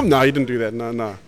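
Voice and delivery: ordinary talk, nothing marked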